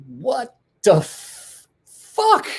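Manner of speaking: high, slow, stretched out what the fuck; waking up startled and groaning in disbelief; startled yelp. Retold or imitated. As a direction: drag the curse out, rising to a shrill peak on fuck. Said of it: "I'm like".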